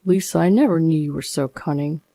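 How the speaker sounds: usual speaking voice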